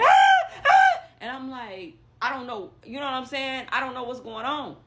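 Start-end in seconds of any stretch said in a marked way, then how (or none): 0.0-1.0 loud, high mock screaming; piercing high scream; retold scream. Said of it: "And I mean screaming".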